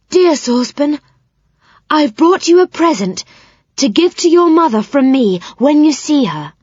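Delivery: loud, high, carrying call; loud and clear so he hears; loudest voice. Acted. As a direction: speak up loudly to a deaf old owl. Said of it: "she said in her loudest voice".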